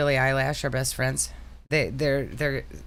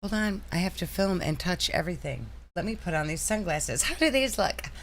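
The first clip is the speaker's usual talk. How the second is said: higher, vain influencer voice; mocking Amber; 7 semitones higher than usual. Wife mocking a vlogger while shopping, higher voice. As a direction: preen over each item like a vlogger. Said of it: "Could you imagine going shopping with Amber?".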